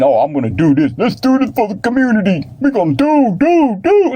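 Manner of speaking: high, hammed-up eager voice; mocking the talkers. Mocking people who only talk about helping, high and hammed-up. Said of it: "when you thinking".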